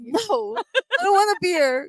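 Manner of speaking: high, drawn-out whiny no; high whiny refusal. Retold or imitated. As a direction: whine the refusal like a sulky partner. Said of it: "I'm like".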